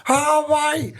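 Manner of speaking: high, drawn-out wail; mock distress in a put-on high voice; imitating a workmate. Workmate back from the burning shoes, imitated high moan. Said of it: "this is how he talks".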